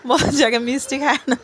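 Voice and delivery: giggling through the words; can't stop laughing while eating a sweet; real laughter breaking into speech. Real, not acted. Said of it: "হাসি আসতেছে মিষ্টি খাওয়ার সময়".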